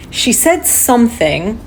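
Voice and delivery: ordinary delivery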